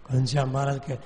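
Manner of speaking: plain reading voice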